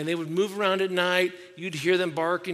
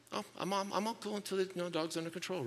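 quiet retold wife; 2 semitones higher, 8 dB quieter, faster than usual. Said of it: "She's like".

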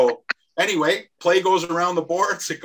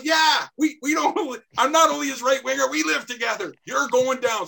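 heated yell; 11 semitones higher than usual. Retold or imitated. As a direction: shout him down, high and hard. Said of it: "screaming at each other".

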